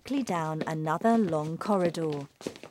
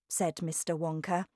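usual speaking voice

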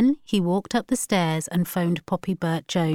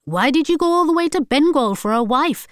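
higher, louder mocking voice; mocking relatives; 8 semitones higher, 5 dB louder than usual. Wife mimicking relatives' taunts, mocking. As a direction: mimic the family's jeer. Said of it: "they will ask".

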